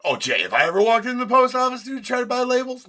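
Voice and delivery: high, loud burst of disbelief; excited outburst. Real, not acted. Telling Jay about the post office, high and excited. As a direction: voice jumps up, laughing in disbelief.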